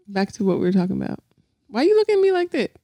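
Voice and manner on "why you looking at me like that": high, quick teasing question; playful high squeal. Real, not acted. Teasing husband's look.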